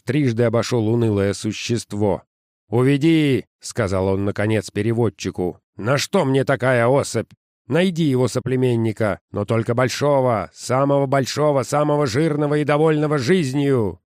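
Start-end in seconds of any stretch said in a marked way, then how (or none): 2.7-3.4 loud, raised command; barked order. Acted. Boss orders translator. 5.8-14.0 irritated boss. Acted. Boss demanding a better specimen.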